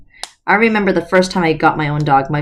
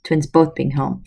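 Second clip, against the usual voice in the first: usual speaking voice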